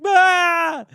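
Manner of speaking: loud, high imitated bawl; mock crying wail. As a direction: bawl like a little kid, hammed up. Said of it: "and I started crying".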